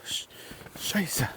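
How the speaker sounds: whispered swear; hushed curse under breath. Real, not acted.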